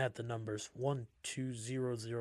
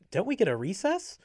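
cutesy; 9 semitones higher, 9 dB louder than usual. A narrator's reading. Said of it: "as the cutesy one said that".

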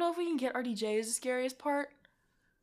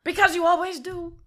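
impression attempt; 7 semitones higher, 6 dB louder than usual. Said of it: "I can't do his voice".

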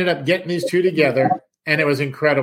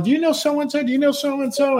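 much higher, rapid-fire eager questions; eager mom; 11 semitones higher than usual. Retold or imitated. Mom quizzing the stranger, excited and high. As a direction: bubble over with names to check.

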